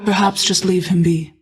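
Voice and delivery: ordinary talk, nothing marked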